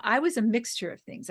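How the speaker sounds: usual speaking voice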